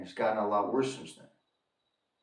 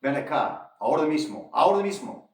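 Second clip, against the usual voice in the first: louder, higher barked order in Spanish; imitated cop's yell; 5 semitones higher, 5 dB louder than usual. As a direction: call them over like an impatient cop, building on the repeat. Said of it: "One of the guys yells out".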